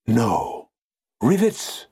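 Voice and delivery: can't believe his ears, voice leaping; incredulous exclamation. A narrator's reading. Foreman hearing the rivets are coming, exclaiming in disbelief.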